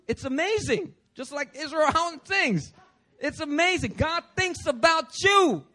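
high, rising to a loud finish; excited preaching. Real, not acted. Preacher telling congregation God thinks of them, excited exclaiming. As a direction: exclaim it with mounting wonder.

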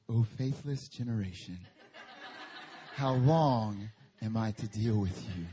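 imitating a stiff, solemn reading of Jesus; mock solemn Jesus. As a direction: drag it out in a pompous lament. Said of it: "No, Jesus ain't like that".

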